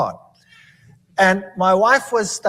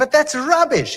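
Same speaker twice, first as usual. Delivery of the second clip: dismissive; 8 semitones higher than usual. Retold or imitated. Dismissing friend's claim.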